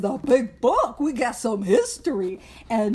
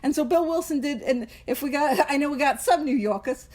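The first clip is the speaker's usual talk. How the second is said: ordinary talk, nothing marked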